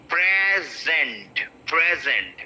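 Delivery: noun "present" as PREZ-ent; weight on PRE in "present", slowly then naturally; stress on first syllable. Shown as a demonstration. Teacher demonstrates the gift word, first-syllable stress on "present". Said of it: "The first one".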